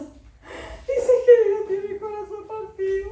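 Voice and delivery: put-on high, slow, tearful child voice with a laugh; imitating a crying child. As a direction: mimic the boy sobbing that God is heartbroken. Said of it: "Mateo se va a llorar". (imitando a un niño que llora, agudo y lento)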